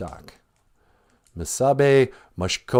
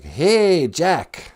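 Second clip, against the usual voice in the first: higher, drawn-out sly hail; 6 semitones higher than usual. Acted. Goblin hails Jack at the bridge, calling out. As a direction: call out to lure a passer-by over.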